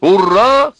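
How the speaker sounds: high, loud hurrah; ringing defiant cry; exclaiming. A narrator's reading. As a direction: cheer it out in mock triumph. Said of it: "воскликнул он".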